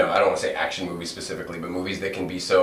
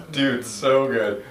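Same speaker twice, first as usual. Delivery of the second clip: laughing; 8 semitones higher, much slower than usual. Real, not acted. Laughing at the impression.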